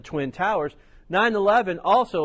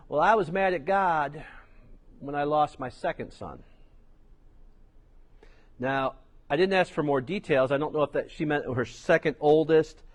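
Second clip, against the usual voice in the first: ordinary delivery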